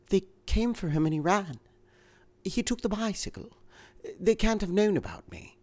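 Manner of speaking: a frightened wife, urgent; anxious woman. A narrator's reading. Woman character in reading.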